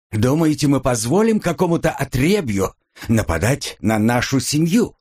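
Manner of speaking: high-pitched, affronted rhetorical question; indignant challenge. Acted. Heir rebuking a policeman over his family, indignant challenge.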